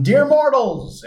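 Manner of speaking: high, slow grandiose announcement; mock villain. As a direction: address the mortals like a cartoon villain. Reading a donation message.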